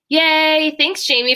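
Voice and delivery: excited. Real, not acted. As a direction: celebrate a viewer. Cheering a viewer in chat.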